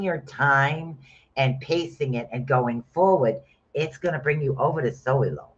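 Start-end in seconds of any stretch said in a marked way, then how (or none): none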